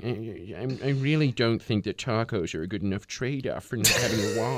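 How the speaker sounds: lightly voiced political pundit; a hint of a TV commentator; Fox News pundit. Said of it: "Fox News contributor just going".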